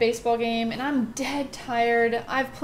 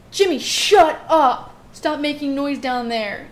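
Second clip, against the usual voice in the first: loud, drawn-out yell at a brother, reenacted; retold yell; 3 semitones higher, 7 dB louder, slower than usual. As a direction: yell down through the vent, imitating annoyance. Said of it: "I yell down there".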